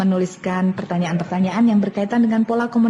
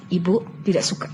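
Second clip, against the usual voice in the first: displeased intonation. Shown as a demonstration. Teacher showing parents how a mother sounds displeased, firm. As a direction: let the child hear mother is not pleased. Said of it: "Anda harus katakan intonasinya".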